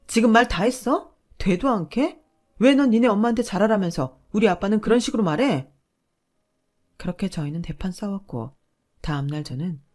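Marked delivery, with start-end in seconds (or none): 0.1-5.7 높고 크게 따져 묻는 분노; 참다못해 터뜨리듯; 격분한 고함. Acted. 아버지 욕에 격분한 아내. (아빠를 깎아내린 남편에게 격분해 소리치는 아내)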